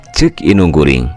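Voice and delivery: plain reading voice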